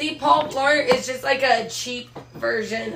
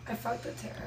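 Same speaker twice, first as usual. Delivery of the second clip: unvoiced whisper; 13 dB quieter, faster than usual. Real, not acted. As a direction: whisper it aside. Quiet aside.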